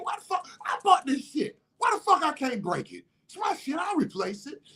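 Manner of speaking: high-pitched indignant outburst; angry yelling. Acted. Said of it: "and I'm yelling".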